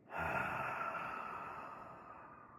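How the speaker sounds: long exhale through the mouth, fading away; demonstrated sigh. Shown as a demonstration. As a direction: let out a long, slow, airy sigh. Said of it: "exhale out through the mouth with a sigh".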